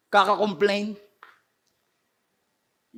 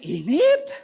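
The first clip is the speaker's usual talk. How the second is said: mock complaining; 5 semitones higher than usual. Preacher mimics complainers about the heat, mock whining. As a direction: pitch swooping up in a whine. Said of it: "nakakainis kasama yung puro reklamador".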